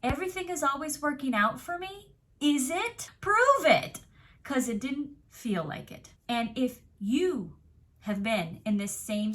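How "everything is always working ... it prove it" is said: rising frustration, higher and louder to a shout on prove it; exasperated outburst. Retold or imitated. Fed-up believer demanding proof from the universe, exasperated rising shout. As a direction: challenge the universe, building to a demand. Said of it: "it was like".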